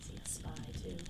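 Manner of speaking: hushed whisper under the breath; whispered aside. Real, not acted. Marlowe whispering to his friend about Dee. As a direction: whisper it so Dee won't hear.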